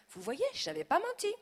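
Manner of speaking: high, loud triumphant cry; exclaiming. A narrator's reading. Child exclaiming to the guests, triumphant cry. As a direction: burst out proud to be right. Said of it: "Je m'exclame".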